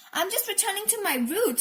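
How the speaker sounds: mildly hammed-up exclamation; crying out. A narrator's reading. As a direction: proclaim it eagerly. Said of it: "Dad cried".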